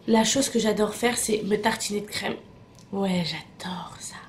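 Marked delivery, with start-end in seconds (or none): 2.9-4.2 slow, hushed relish; savouring whisper. Real, not acted. Vlogger confiding a love of body cream, whispered. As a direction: whisper it slowly, savouring every word. Savouring a favourite pampering ritual.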